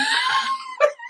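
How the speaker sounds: high-pitched burst of laughter; real squealing laugh; helpless laugh. Real, not acted. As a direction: crack up at your own story. Laughs at her own confession.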